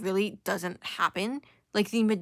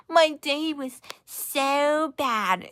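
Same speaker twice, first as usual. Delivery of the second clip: mock whining; 6 semitones higher, 3 dB louder, much slower than usual. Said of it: "talking about".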